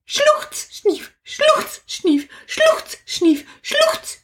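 high, mock sniffles and sobs; hammed-up sobbing. Lovesick crocodile wailing over the lost wizard, mock sobbing. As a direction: sob like a puppet crocodile, overdone. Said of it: "Jetzt kommen mir glatt die Krokodilstränen".

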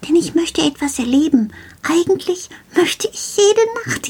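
an excited little gnome sharing his nightly plan; Karl the shelf gnome. Acted. Gnome tells the child he will come every night, lightly voiced and eager.

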